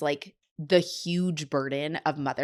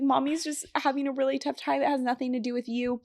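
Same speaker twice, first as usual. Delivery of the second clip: gentle reassurance; 7 semitones higher than usual. Retold or imitated. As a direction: reassure a small child, pitched up. Retelling reassuring her young son.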